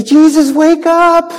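high, pleading call; scared disciples shaking Jesus awake; urgent plea. Acted. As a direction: wake him up in a panic. Disciples wake Jesus in storm.

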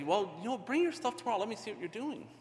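high, eager offer from an odd street guy; a lightly voiced quirky street man, friendly; the odd guy's voice. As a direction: pitch it up as the eccentric fellow. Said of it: "this fellow would say".

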